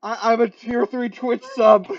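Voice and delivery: high, loud dopey bragging voice; squeaky show-off voice; goofy impression. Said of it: "you're basically like".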